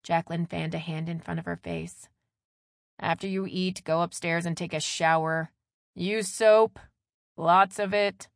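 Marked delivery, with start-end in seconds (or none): 3.0-8.3 firm mother, louder; bossy mom telling son to wash; stern mom. A narrator's reading. Mother ordering shower.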